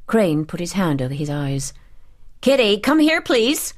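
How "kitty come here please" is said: high, loud imperious call; angry summons pitched well up; shouted summons. A narrator's reading. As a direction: call the servant in, voice raised. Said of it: "she shouted".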